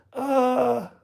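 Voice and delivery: very high, loud held "ah"; imitated yell. Retelling the hammer blow to the kneecap, imitated high yell. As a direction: mimic a high-pitched attack cry.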